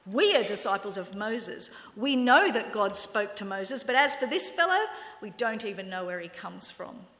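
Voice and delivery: loud, scornful taunting; jeering Pharisees shouting down the beggar; insulting sneer. Acted. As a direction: hurl it at him like an insult. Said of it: "Then they hurled insults at him".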